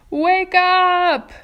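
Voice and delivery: a high, loud shout to rouse a sleeper; loud wake-up call. Acted. Centipede yelling at the sleeping glowworm, loud high shout. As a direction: yell her awake, high and loud.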